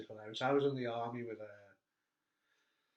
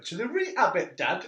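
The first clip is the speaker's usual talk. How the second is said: imitating a Geordie lad, high and loud; comic high-pitched Geordie; mock Geordie accent; 5 semitones higher, 8 dB louder than usual. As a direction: play the son in a hammed-up Geordie accent. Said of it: "well, Geordie".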